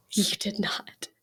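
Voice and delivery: whispered "you did not", voice dropped low and soft; disbelieving whisper. Retold or imitated. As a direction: hiss it under your breath in disbelief. Reacts to him eating alone.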